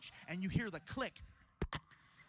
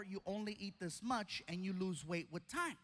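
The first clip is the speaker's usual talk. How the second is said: ordinary delivery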